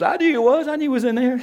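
high-pitched imitation of wife; mock protest. Sleepy wife insisting to her husband she knew him, high mock protest.

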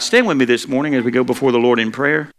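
usual speaking voice

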